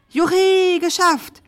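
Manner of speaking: high, loud victory whoop; triumphant cry. A narrator's reading. Knight after trapping the dragon, cheering triumphantly. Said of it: "hat Tim gerufen".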